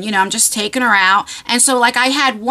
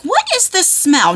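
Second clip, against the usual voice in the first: puzzled sniff; 7 semitones higher than usual. Retold or imitated. Noticing a bad smell.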